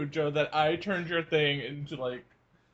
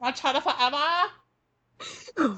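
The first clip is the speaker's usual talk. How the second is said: slow, higher, hammed-up caricature voice; 7 semitones higher, much slower than usual. Friends replaying a joke recording, in mock caricature voice. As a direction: drag it out in a put-on caricature. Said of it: "My racist Chinese thing?".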